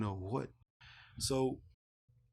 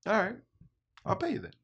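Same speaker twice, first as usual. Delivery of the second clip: much higher, breezy offer; stranger offering pay; 10 semitones higher, 3 dB louder, faster than usual. Retold or imitated. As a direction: toss off the offer like it's nothing. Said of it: "he's like".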